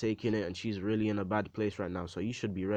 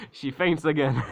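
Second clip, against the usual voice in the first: higher, louder, through laughter; laughing; 7 semitones higher, 6 dB louder than usual. Real, not acted. Storyteller amused that the mother faints again, laughing. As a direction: crack up while telling it.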